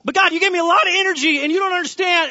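high, loud, worked-up protest; preacher playing himself pleading with God; frantic rant. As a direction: rant like the world is on fire. Acted frantic self.

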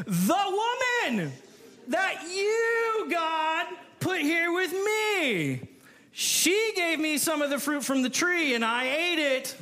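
high, slow whiny blame; whining Adam. Acted. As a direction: play Adam whining and blaming.

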